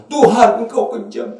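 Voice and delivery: high-pitched mock complaint to God; acted accusing lament, pitched high; blaming God. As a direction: wail it upward like a wronged child of God. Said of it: "Terus kita menyalahkan Tuhan".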